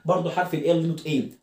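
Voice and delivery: normal talking voice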